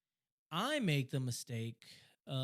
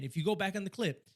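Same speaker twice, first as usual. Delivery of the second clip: normal talking voice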